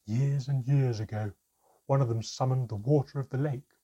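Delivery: loud, aggrieved account; bitter storytelling. A narrator's reading. Mermaid tells how sorcerers left her stranded, bitter. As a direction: recount the grievance with force.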